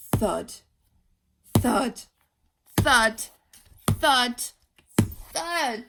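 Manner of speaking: slow booming thud, thud, thud; heavy footsteps. A narrator's reading. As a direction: stomp each word like a giant's step. Footsteps of approaching monster.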